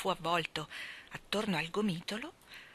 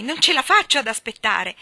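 loud, raised, eager voice; impatient exclamation; 4 semitones higher, 10 dB louder than usual. A narrator's reading. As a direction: burst out, unable to wait. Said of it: "esclamò Jane". (esclamazione impaziente)

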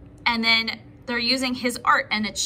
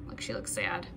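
whisper; 12 dB quieter than usual. Real, not acted. Aside to preschoolers about picture.

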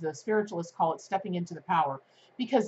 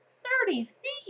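amazed; slower than usual. Real, not acted. Amazed at the kangaroo leap.

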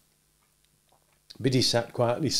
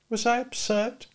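put-on higher young woman, gently doubtful; Biddy warning Pip off being a gentleman, higher voice; Biddy's voice; 13 semitones higher than usual. A narrator's reading. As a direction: voice Biddy a good deal higher, kindly advising.

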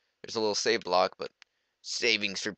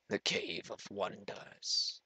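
slow, hushed whisper; mysterious whisper; 10 dB quieter, much slower than usual. Pretend. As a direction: breathe the cave's name out slowly, like a legend. Announcing the cave's name mysteriously.

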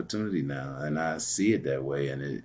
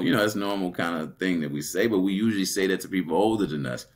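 normal talking voice

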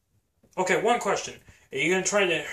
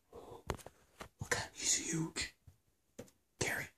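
whisper; 12 dB quieter, slower than usual. Acted. Hiding from the Baryonyx.

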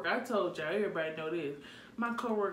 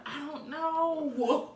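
drawn-out mock wail; mock crying; 6 semitones higher, much slower than usual. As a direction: whine it slowly, as if crying. Retelling her crying answer.

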